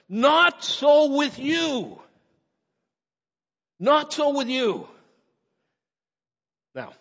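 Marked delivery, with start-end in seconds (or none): 0.1-4.9 "Not so with you" high, loud and drawn out; emphatic. Acted. Jesus correcting his disciples, loudly emphatic on "Not so with you". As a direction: hammer "Not so with you" slowly, letting it fall. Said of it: "Jesus said it with emphasis".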